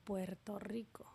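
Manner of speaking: whispered, unvoiced, puzzled to herself; a muttered doubt while searching his profile. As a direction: whisper it as if thinking aloud. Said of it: "y dije".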